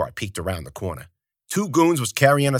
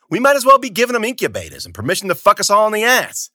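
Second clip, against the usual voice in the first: loud, high furious rant; angry shouting; 9 semitones higher, 6 dB louder than usual. Acted. Zoot berating Bruno about the fodder, loud high yell. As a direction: hammer every word at him.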